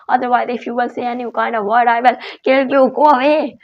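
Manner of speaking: loud, high, fast angry tirade building to a yell; furious master shouting down the slave. Acted. Master threatens slave, rising rage.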